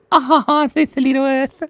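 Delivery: high, playful squeal; cutesy little voice. Pretend. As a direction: coo high over something tiny. Coos over tiny drawing.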